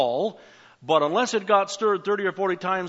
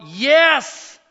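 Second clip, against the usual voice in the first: loud, high eager yes; crying out yes; 7 semitones higher, 9 dB louder than usual. Pretend. Believer answers God's offer of healing, crying out. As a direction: cry out yes to the Lord. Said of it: "wouldn't we cry out".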